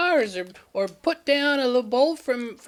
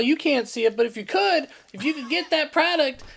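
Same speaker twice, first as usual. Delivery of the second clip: ordinary delivery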